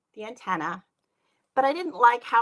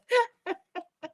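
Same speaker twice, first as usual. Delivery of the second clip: high giggle; 11 semitones higher than usual. Real, not acted. Apologizing to Meredith.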